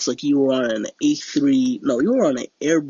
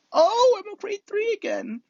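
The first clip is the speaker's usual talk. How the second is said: jumps far higher on a sudden oh; dismayed surprise. Real, not acted. Streamer sees his pilot grade dropped, high surprised oh. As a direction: caught off guard by bad news.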